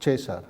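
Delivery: "César" as CHEH-sar; Italian-style "César"; church Latin. Shown as a demonstration. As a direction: Roman CH in "César". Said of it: "se ha convertido en E".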